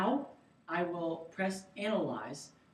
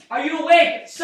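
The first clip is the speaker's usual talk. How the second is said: loud call to an unresponsive man; shouting to wake; 10 semitones higher, 16 dB louder than usual. Shown as a demonstration. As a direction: raise your voice to rouse him. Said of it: "check if the patient is conscious or unconscious by tapping and shouting".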